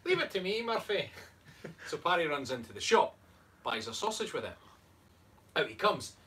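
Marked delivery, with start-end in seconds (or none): 0.0-1.1 put-on higher-pitched, joke-telling Irish accent; attempted Irish accent. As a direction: have a go at an Irish character. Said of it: "say it with an Irish accent".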